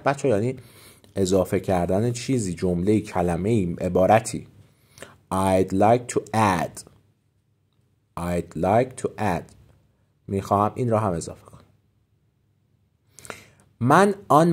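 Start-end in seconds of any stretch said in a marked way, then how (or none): 5.3-9.4 slow model phrase. Shown as a demonstration. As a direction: say it slowly and gently for learners. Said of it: "teacher models phrase".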